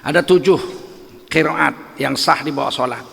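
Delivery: ordinary delivery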